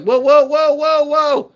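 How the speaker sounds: mock panicked protest; alarmed whoa. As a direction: leap up in alarm. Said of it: "I was like".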